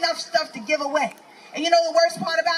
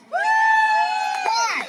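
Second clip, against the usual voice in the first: a sudden, much higher yelled why; exasperated shout. Real, not acted. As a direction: throw the question at the crowd, outraged. Ranting at crowd about Puritans.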